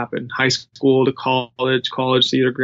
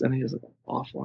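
normal talking voice

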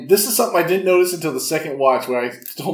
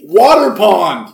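slow, loud and indignant; mocking shout at the line; 3 semitones higher, 8 dB louder, much slower than usual. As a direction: shout it in mock disbelief.

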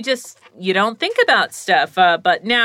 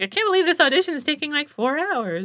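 high whiny complaint; 8 semitones higher than usual. Retold or imitated. Tatum complaining about long audition.

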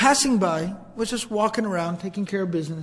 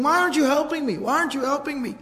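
higher-pitched, acted panicked plea; freaking out; 6 semitones higher, faster than usual. A man pleads with his watching family, mock panic. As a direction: imitate a man pleading in alarm. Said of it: "he's freaking out".

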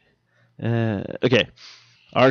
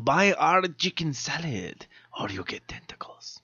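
slow mock commercial voice fading to a whisper; mock ad pitch; 9 dB quieter than usual. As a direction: sell it like a creepy ad, then whisper.

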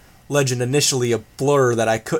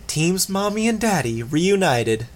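mock delight; 6 semitones higher than usual. A narrator's reading. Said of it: "Imp commented".

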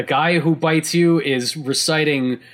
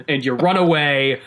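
ordinary talk, nothing marked

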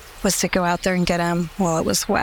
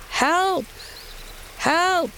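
high, rhythmic cries of help, repeated in steady beats; rhythmic yelling for help; 9 semitones higher, much slower than usual. Retold or imitated. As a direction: call out help over and over like a chant. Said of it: "in a super rhythmic way".

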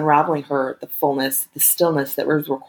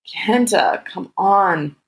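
higher, drawn-out frustrated plea; 4 semitones higher, much slower than usual. A narrator's reading. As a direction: plead with a touch of impatience. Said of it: "Her voice was tinged with frustration".

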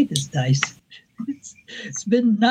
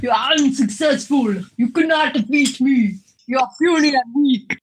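booming echoing villain monologue; 4 semitones higher than usual. Acted. Player voices taunting shadow enemy.

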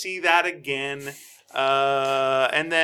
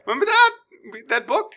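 high, a bit louder silly reminder; mock goofy character; 11 semitones higher, 5 dB louder than usual. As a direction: pitch it up like a cartoon recalling a prop. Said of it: "it really feels like they say this".

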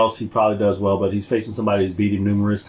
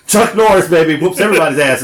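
hyped shout; 6 semitones higher, 6 dB louder than usual. Real, not acted. Hyping Chuck Norris.

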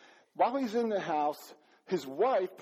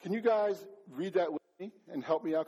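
normal talking voice

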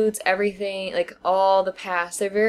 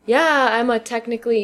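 higher, louder mock-defiant retort; sarcastic; 5 semitones higher, 5 dB louder than usual. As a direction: imitate women owning a label sarcastically. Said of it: "they use it sarcastically".

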